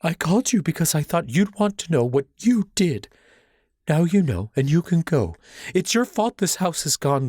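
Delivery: high, strained tearful accusation; tearful blame. Acted. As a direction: blame him through tears. Tearful mother blaming her son.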